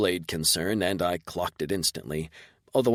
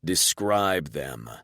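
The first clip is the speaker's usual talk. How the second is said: slow, cutting and cold; strict, slashing command; 3 semitones lower, much slower than usual. Acted. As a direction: slice each word slowly. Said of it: "so that your voice slashes and rips".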